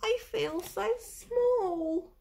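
high, slow little snail voice; a tiny creature's wondering voice. Acted. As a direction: make the snail sound small and awed. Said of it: "she said to the whale".

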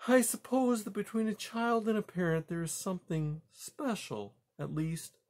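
high-pitched, tentative mother; earnest mother mouse. Acted. Mother mouse Poppy speaking.

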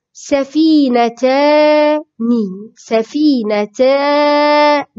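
سفينتان slowly, holding "taaa" before a short "ni"; modelled pronunciation. Shown as a demonstration. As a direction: stretch the long aa in سفينتان. Teacher models the dual word. (نطق سفينتان ببطء مع مد الألف)

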